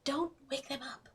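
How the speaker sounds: hushed whisper, don't wake anyone. Acted. Player whispers so Aiden isn't woken, hushed. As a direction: breathe it so the sleepers stay asleep.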